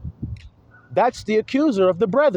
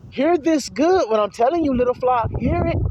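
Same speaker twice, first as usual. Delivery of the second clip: calling out high; 5 semitones higher than usual. Real, not acted. Calling out to congregation.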